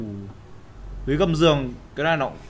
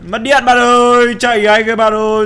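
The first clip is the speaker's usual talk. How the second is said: panicked yell; 6 semitones higher, 8 dB louder than usual. Real, not acted. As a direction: yell for your friend in the dark. Lights go out, panic. (hoảng hốt hét to)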